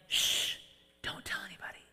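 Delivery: unvoiced secret whisper; hushed whisper. Preacher voices Jesus hushing the healed leper, whispered. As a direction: shush him like a secret.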